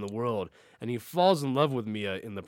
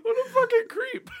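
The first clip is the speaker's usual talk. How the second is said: laughing. Real, not acted. Laughing at the stalker ending.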